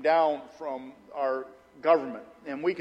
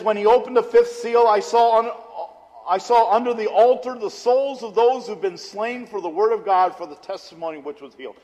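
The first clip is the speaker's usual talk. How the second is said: scripture reading; 4 semitones higher, 6 dB louder than usual. Acted. Reads scripture aloud.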